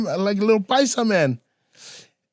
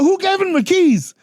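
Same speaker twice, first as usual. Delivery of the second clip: alarmed shout; 12 semitones higher than usual. Retold or imitated. Friends spot the drunk guy holding the keys, alarmed yell. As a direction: yell it like someone just spotted danger.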